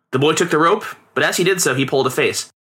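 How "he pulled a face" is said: ordinary delivery, nothing marked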